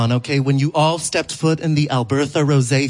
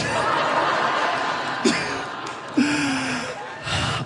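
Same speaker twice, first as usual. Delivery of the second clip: breathy laugh; 11 semitones higher, 7 dB quieter than usual. Real, not acted. Crowd-pleasing punchline laugh.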